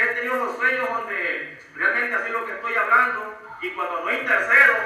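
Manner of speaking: normal talking voice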